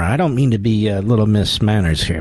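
normal talking voice

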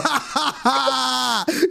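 high, drawn-out burst of laughter. Real, not acted. Laughing at fooled co-host, high cackling laugh.

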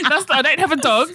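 high laughing protest. Real, not acted. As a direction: defend it while cracking up. Laughing defence.

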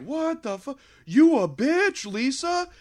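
high, loud, slow accusing yell; hammed-up shocked accusation; mock outraged crowd. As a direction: everyone turning on the mom in mock outrage. Everyone accusing the mom.

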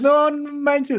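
high, amazed exclamation; excited. Real, not acted. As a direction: gush it out in awe. Thrilled memory of the show.

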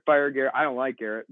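ordinary talk, nothing marked

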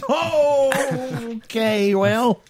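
high, drawn-out scandalized reaction; high startled backpedal; shocked. Real, not acted. Shocked reaction to offensive joke.

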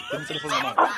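whispered with a stifled laugh; whispered aside. Acted. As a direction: murmurlo conteniendo la risa. Aparte susurrado con risa. (susurro con risa contenida)

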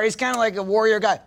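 usual speaking voice

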